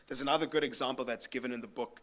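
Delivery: normal talking voice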